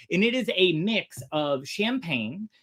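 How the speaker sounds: usual speaking voice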